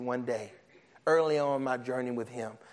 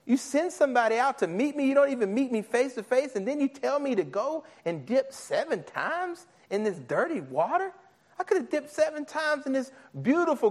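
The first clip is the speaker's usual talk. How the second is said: offended Naaman; 8 semitones higher than usual. Acted. Preacher acting Naaman offended at the prophet, indignant. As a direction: play the insulted general, outraged and pitched up. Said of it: "He's offended".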